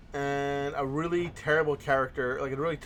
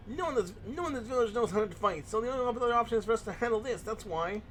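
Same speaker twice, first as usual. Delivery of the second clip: whiny voice; 7 semitones higher than usual. Acted. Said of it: "I give him the whiny voice".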